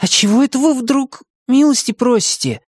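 high-pitched, suspicious little hero; wary, high-voiced querying. A narrator's reading. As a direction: question the politeness in a high character voice. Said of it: "подозрительно спросил он".

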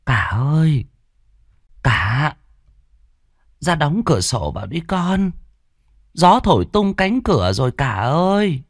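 put-on high, thin old woman's voice calling her son; frail old mother calling. A narrator's reading. Old mother in the cold calls her eldest son, high and frail. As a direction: call weakly to the eldest son, shivering with cold. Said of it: "Bà gọi hai thằng con".

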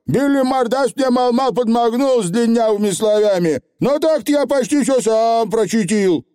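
high, chattering brag; tiny creature pleased with itself; proud Feegle boast. A narrator's reading. Feegle bragging that he read almost alone, proudly. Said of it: "гордо выпятил грудь Явор".

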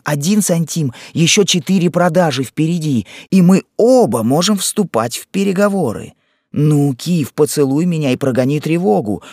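wife rallies her husband with a plan, rising eagerness; growing excitement. A narrator's reading. Wife counts down her rescue plan.

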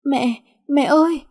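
high, slow, straining cry for mother; a frail voice trying to shout for help; weak desperate call. A narrator's reading. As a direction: call out with the last of her strength. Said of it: "thều thào mà cố hết sức gọi lớn lên".

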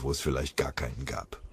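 ordinary delivery, nothing marked

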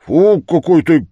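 loud, high, embarrassed scolding; mortified. A narrator's reading. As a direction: blurt it out in hot embarrassment. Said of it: "произнёс страшно сконфузившийся и покрасневший".

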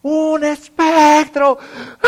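alarido agudo, lento y muy fuerte; grito aterrado. Acted. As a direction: grita alargando las palabras, con espanto exagerado. Acts out disciples' terrified scream. (discípulos aterrados, grito teatral)

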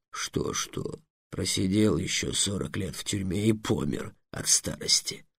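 soft, low grumbling reply; grumbling dragon. A narrator's reading. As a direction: grumble it out under the breath. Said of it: "ворчливо ответил дракон". (ворчливо и тихо)

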